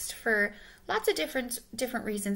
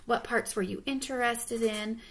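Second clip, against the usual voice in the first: plain reading voice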